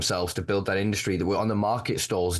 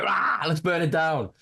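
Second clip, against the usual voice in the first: mock rage; 7 semitones higher than usual. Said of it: "And I'm like".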